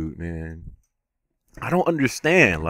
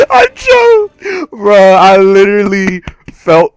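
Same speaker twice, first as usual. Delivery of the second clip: high, loud wail of frustration; anguished shout; 7 semitones higher, 10 dB louder, slower than usual. Real, not acted. Player who just choked the fight, anguished shout. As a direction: cry it out loud, high at the start.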